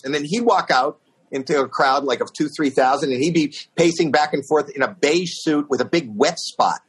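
normal talking voice

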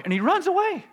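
very high, loud squeak of disbelief; incredulous. Real, not acted. Preacher baffled that Elijah fled from Jezebel, incredulous. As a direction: let the voice leap up high in bafflement.

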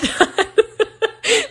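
bright high-pitched giggle; high laugh. Real, not acted. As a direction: laugh at yourself. Laughs at too few questions.

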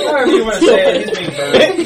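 laughing. Real, not acted. Laughing at burning troll.

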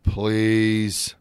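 long, level, grudging please; frowny-faced please. Shown as a demonstration. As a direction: drag it out through a frown. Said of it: "You got a frowny face".

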